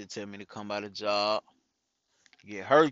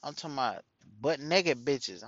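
normal talking voice

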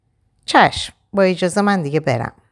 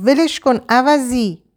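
higher, louder, slow furious order; angry yell; 9 semitones higher, 4 dB louder, slower than usual. Acted. Shadi shouting at the husband dragging Ava, furious. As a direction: spit the insult at him.

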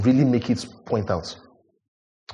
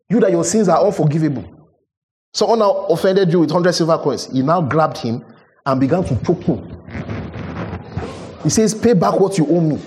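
impassioned preaching; 6 semitones higher than usual. Acted. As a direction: drive the debt image home with fire. Preaching at full force.

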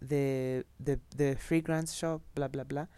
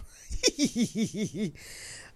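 real laugh; 6 semitones higher than usual. Real, not acted. Laughs at own joke.

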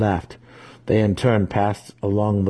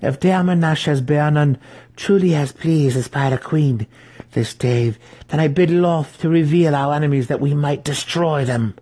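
higher and louder, impassioned; fervent plea; 8 semitones higher, 4 dB louder than usual. A narrator's reading. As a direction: demand vengeance on her enemies. Matron pleads fervently with the demon.

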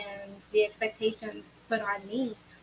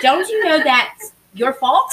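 laughing comeback; 6 semitones higher, 14 dB louder, slower than usual. Retold or imitated. Her laughing comeback to daughter.

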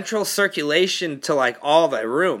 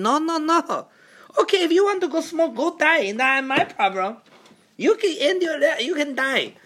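imitating his mom; 10 semitones higher than usual. Mom describing the smell in her room, high accented worry. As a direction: ham up a rattled mom telling her night. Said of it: "what National Enquirer are you reading".

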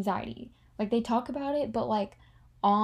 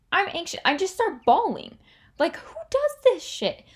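high anguished exclaim; 9 semitones higher, 4 dB louder than usual. Retold or imitated. Reliving breakdown in Florence.

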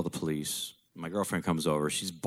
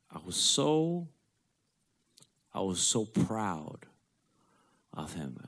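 choked up; 3 semitones higher, slower than usual. Real, not acted. Son recalling pride in his dead father, choked up. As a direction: strain through swelling emotion, drawn out.

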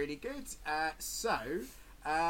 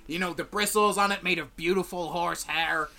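lightly voiced scruffy salesman; a fawning trader reeling off his wares; stall merchant; 2 semitones higher, 8 dB louder than usual. GM as merchant selling tools to the party, lightly voiced.

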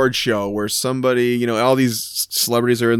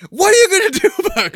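mock indignant Mary; 4 dB louder, much faster than usual. Mary confronts the manager.

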